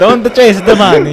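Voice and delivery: high, loud hammed-up Italian; Italian accent. Shown as a demonstration. Repeating his money tip for laughing friends, comic Italian accent. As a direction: belt it out in mock Italian. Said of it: "Can you do Italian?".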